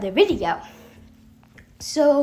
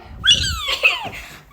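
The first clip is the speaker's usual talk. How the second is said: very high squealed "whee"; zooming whee. Retold or imitated. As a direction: squeal like someone whizzing past. Imitates the runner zooming past.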